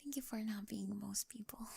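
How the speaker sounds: soft, grateful whisper; tender whisper. Acted. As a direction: whisper your thanks, close and sincere. Tender thanks after first kiss.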